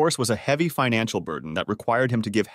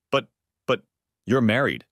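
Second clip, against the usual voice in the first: stammered. Acted. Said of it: "he stammered".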